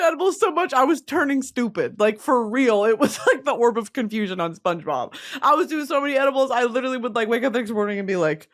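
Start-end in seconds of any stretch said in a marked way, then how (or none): none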